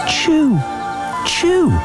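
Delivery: high squeaky voice; squeaky chew. Acted. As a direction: squeak the word out.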